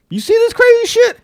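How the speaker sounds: high, amazed exclamation; excited. Retold or imitated. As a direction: pitch way up in disbelief. Someone hyped about a viral clip.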